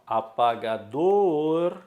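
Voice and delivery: APAGADOR with a long, stretched DOR; stressed final syllable. Shown as a demonstration. Teacher giving an oxítona example, stress on DOR in "apagador". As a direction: hold and push the last syllable of "apagador". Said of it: "é uma oxítona".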